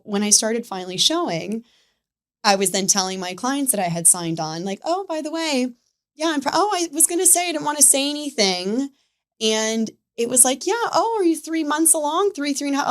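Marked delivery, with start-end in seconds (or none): 4.8-8.9 higher-pitched, offhand acted quote; mock-casual announcement. Acting out telling clients about pregnancy. 10.7-12.9 high-pitched imitation of a client guessing; mock polite questioning, up high; imitating curious clients. Voicing clients guessing months along.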